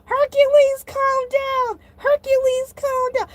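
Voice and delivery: very high-pitched frantic shriek; hysterical. Acted. Shark yelling at himself mid-meltdown, hysterical. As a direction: shriek at yourself to get a grip.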